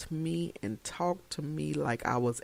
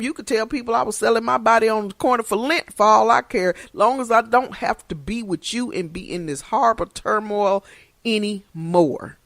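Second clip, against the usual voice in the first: fed-up outburst; 7 semitones higher, 8 dB louder than usual. Retold or imitated. Retelling telling husband off.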